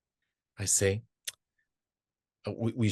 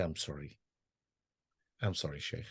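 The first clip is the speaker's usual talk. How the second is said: quiet apology; 6 semitones lower, 11 dB quieter, faster than usual. Retold or imitated. Apologising to the sheikh.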